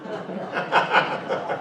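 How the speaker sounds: quiet laugh at his own joke; soft breathy laugh. Real, not acted. Comic laughing softly after his punchline, breathy chuckle.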